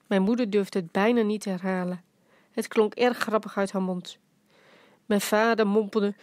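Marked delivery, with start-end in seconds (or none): none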